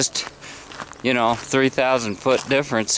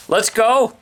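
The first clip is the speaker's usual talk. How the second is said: excited; 13 semitones higher, 5 dB louder than usual. Real, not acted. Setting off on a hike.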